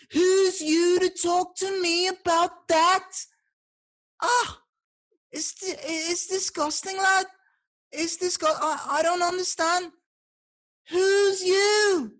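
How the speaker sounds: very high-pitched Scouse accent; indignant, drawn-out Liverpool squawk. Shown as a demonstration. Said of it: "Liverpool accent, let's have it".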